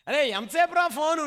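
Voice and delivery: high, sharp call of a scolding mother; mother scolding her son about his phone, high and sharp. Retold or imitated. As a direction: nag the kid off his phone.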